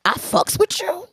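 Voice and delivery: high, incredulous echo of his line; mocking quote. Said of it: "what is".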